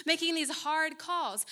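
usual speaking voice

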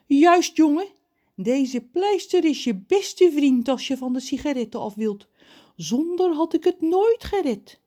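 high, loud character voice; story character John. A narrator's reading. John praises the plaster to Ron, high and emphatic. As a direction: confirm it emphatically, pitched high.

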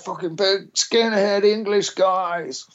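high, slow, mock-scared warning; scared friend. Friend warning about the skinheads, high and slow. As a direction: drag the words out high like a frightened mate.

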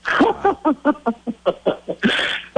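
high-pitched laughing burst; real high chuckling laugh. Real, not acted. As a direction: laugh freely at your own story. Host and guest enjoy the punchline.

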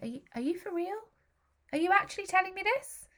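high-pitched, disbelieving question; incredulous disbelief. Retold or imitated. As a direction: ask as if it can't be true. Acting out her stunned disbelief.

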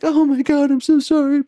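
high, mock tearful apology; mock crying girlfriend. Crying girlfriend apologizing on the phone, high mock sob. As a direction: squeak it like a sobbing girlfriend. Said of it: "she's crying at this point".

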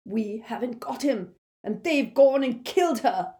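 frantic. A narrator's reading. Amycus frantic.

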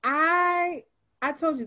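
ordinary talk, nothing marked

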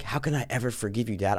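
mocking the movie daughter's hushed guilt trip; mock whiny daughter. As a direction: whine it like a brooding teen. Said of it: "She's like".

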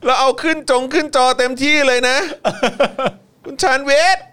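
high, loud hyped-up announcing; hyped shout. Acted. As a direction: shout it up like a hype man. Hyping the name on screen. (ตะโกนเชียร์สุดเสียง)

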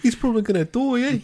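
high, teasing Kiwi voice; mock Kiwi with eh; Kiwi accent. Mocking Kiwi voice.

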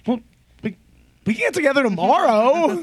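high, loud eager reply; excited planning. Retold or imitated. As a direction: blurt it out with glee. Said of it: "And I was like".

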